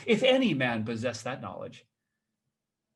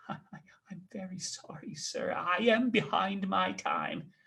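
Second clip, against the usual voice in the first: much softer, slow frightened apology; timid apology; 4 semitones higher, 7 dB quieter, slower than usual. Acted. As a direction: shrink back and apologize.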